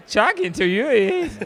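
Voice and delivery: mock authentic Australian accent. Shown as a demonstration. Showing friends his authentic Australian accent, hammed-up. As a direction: stretch out Chucking two Yuis in an over-the-top Australian accent. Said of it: "the authentic accent".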